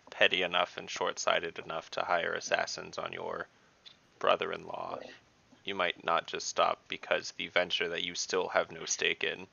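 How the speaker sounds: ordinary talk, nothing marked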